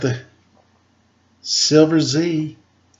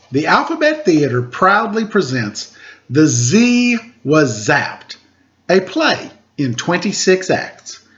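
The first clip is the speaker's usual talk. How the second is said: higher, grand stage announcement; theatrical announcer; 5 semitones higher than usual. Acted. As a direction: present the title like a curtain rising. Announces the book title.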